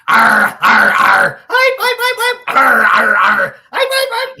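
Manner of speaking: loud growls switching to high yelps; alternating snarls and shrill dog yelps; imitated dog fight. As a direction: mimic snarling and high-pitched yelping, very loud. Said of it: "and you hear this".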